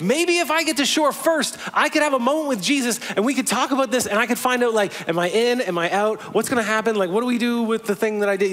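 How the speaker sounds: high, rushed, anxious hoping as Peter. Retold or imitated. Peter swimming to Jesus, anxious and high. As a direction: pitch way up, worried and pleading. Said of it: "he's like".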